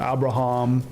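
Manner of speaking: Abraham said as AB-ruh-HAHM; imitating a botched Abraham, AB-ra-hahm; mispronounced name. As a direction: end Abraham on a broad HAHM. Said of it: "I think is what he said".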